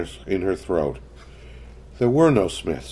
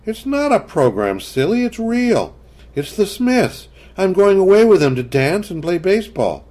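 put-on much higher, matter-of-fact little girl; a child correcting her mother; child Kathy; 10 semitones higher, 3 dB louder than usual. A narrator's reading. Kathy explaining the Smiths.

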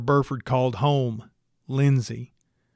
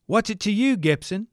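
higher, lightly voiced rancher snapping; shot back; 8 semitones higher than usual. A narrator's reading. Rancher snapping at the investigator, sharp retort. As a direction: fire it back at him. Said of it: "he shot back".

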